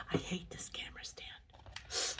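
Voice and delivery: hushed, confiding whisper. Real, not acted. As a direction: whisper the complaint like a secret. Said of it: "I'll just whisper".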